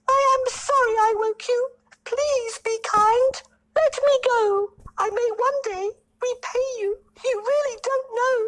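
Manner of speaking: very high squeaky flattering mouse; tiny pleading mouse. A narrator's reading. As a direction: sweet-talk the king in a squeak.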